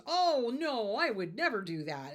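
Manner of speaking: high, loud mock-innocent protest; sly scorpion. Scorpion lying to the frog, mock innocent. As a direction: play the scorpion, all fake innocence. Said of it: "scorpion goes".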